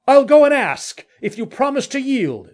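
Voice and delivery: high, carrying call; loud, high-pitched hail; shouting back. Acted. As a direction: call the terms across the dark. Shouted parley in the dark.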